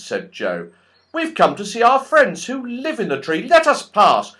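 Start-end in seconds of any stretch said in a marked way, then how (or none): 1.1-4.3 much higher, loud indignant Joe; indignant boy. Acted. Joe scoffs at the goblins, loud and indignant.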